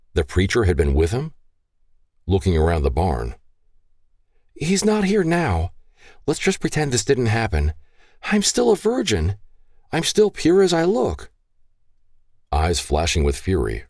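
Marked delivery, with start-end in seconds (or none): 4.5-11.3 put-on high, pleading young woman's voice; pleading daughter. Daughter begging her gun-waving father, high and pleading. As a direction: beg your father to spare him.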